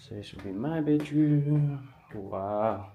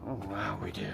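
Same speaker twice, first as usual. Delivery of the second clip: softer whisper of surprise; whispered awe; 5 semitones lower, 11 dB quieter, faster than usual. Real, not acted. Whispering in surprise at finding a garden. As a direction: breathe it out amazed.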